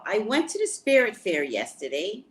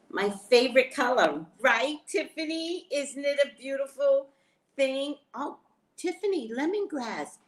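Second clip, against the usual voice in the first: excited; 8 semitones higher than usual. Real, not acted. Excited showing friend new items.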